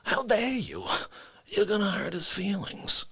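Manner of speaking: hushed, mock-wounded character voice; soft and affronted, shielding a friend; Dick Facce. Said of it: "Facce has the nerve to say".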